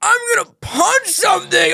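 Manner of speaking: high, strained mock shout; hammed-up angry outburst, laughter creeping in; mock angry dad. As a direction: imitate an angry parent in a high, drawn-out yell. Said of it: "he would try to get mad at me".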